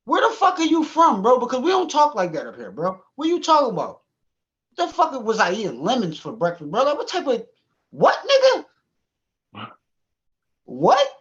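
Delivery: incredulous. Retold or imitated. Incredulous rant at coworker.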